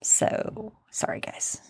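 whispered apology, barely voiced; whispering. Real, not acted. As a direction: whisper it sheepishly. Embarrassed aside to listeners.

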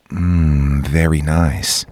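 low, slow seductive murmur; sexy voice. Shown as a demonstration. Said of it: "can you go like this?".

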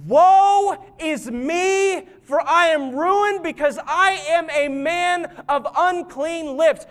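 loud, high, drawn-out lament; slow, high prophetic wail of confession; anguished Isaiah. Acted. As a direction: cry out in woe, stretching the words. Isaiah's cry of woe acted.

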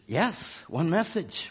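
soft affirmation. Shown as a demonstration.

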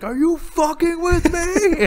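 high, loud, drawn-out outburst; hammed-up comic fury; mock outraged yell. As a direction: yell it slowly like an outraged movie character. Acts out angry movie character.

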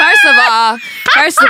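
giggling protest, can barely get it out; laughing. Real, not acted. Cracking up at host's joke.

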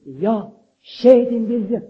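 loud, high, slow cry of praise. Acted. Congregation answering God's promise, loud and slow. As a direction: call it out like the whole church answering. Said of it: "Og vi roper".

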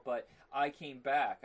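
ordinary delivery

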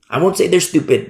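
ordinary talk, nothing marked

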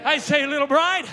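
fiery preaching. Acted. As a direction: stir the congregation up. Full-force preaching.

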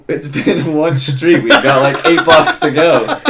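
loud, high, slow mocking retort; mock exasperated friend; friend's exasperated reply. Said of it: "he's like".

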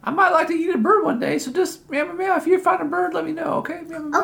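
chatty cartoon cat voice, high; talking cat.

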